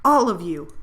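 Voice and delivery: a furious outburst, full force; angry shout. Acted.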